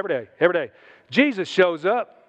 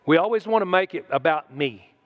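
ordinary delivery

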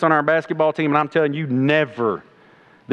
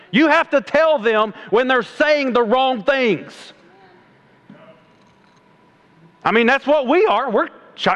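high, fired-up pulpit voice; fiery preaching; 8 semitones higher than usual. Real, not acted. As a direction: preach it hard to the crowd. Preaching at full force.

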